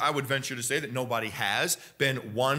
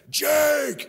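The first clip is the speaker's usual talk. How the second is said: mock shout across a field; 12 semitones higher than usual. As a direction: yell his name from far off. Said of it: "He was like a hundred yards away".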